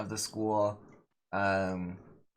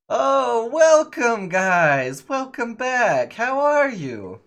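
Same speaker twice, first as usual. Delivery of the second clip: much higher and louder, slow and gushing; 12 dB louder, much slower than usual. Acted. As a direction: fawn over the guests. GM voices gushing Belarius.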